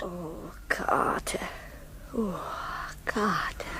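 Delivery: low breathy whisper. Acted. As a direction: sigh his name in a whisper. Whispered intimacy.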